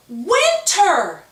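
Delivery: high, loud call of a name; yelling. A narrator's reading. Jacob yells at Winter across the game, loud. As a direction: shout her name to snap her out of it. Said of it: "Jacob yells".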